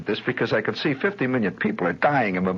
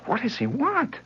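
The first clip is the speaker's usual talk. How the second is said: very high mock-matron echo; mother relaying. Mother repeats her daughter's question to the boy.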